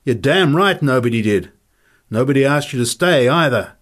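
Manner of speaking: loud, high, mounting tirade; furious rant. Acted. Fighter rants at the freeloading Nick, furious and rising.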